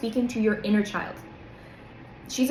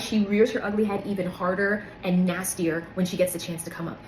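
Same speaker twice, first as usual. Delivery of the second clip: usual speaking voice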